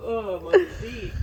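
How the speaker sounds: high, slow pained cry; in ice cold water. Real, not acted. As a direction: wince out the words in icy water. In the ice bath, feet hurting.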